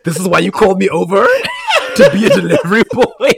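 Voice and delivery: loud indignant whine, laughing; indignant laughing quote. Retold or imitated. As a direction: act offended through laughter. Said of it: "he's immediately like".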